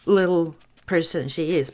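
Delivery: ordinary delivery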